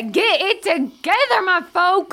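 high, slow, drawn-out call; rallying the crowd. Acted. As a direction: call folk to order from a stump. Calling to the crowd from stump.

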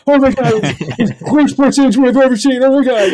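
high, loud, wide-eyed disbelief; reenacting a freakout to his wife; shocked outburst. Telling his wife, a loud shocked freakout. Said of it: "And I was like".

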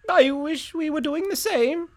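high piping rooster. Acted. Rooster envying the robbers' meal, high and piping. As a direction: wish it aloud as a high little rooster. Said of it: "said the rooster".

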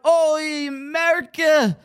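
imitated grandmother's cry of dismay; screamed-out alarm. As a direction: wail it like a panicked bubby. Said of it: "and she screamed out".

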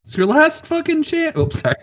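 high tiny fairy voice. Acted. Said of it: "Do you guys like my fairy voice?".

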